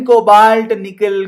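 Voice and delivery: usual speaking voice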